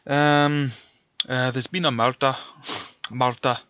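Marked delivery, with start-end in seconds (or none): 1.5-2.3 hammed-up Scottish catchphrase, rolled "murder"; awful Scottish accent. Player joking after the worm fight aside, mock Scottish accent. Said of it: "enough of my awful Scottish accent". 3.1-3.6 "Murder" with a hammed-up Scottish roll; awful Scottish accent. Shown as a demonstration. Player repeating how they say it, bad Scottish accent. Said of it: "That's how they say it".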